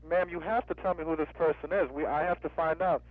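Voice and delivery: ordinary talk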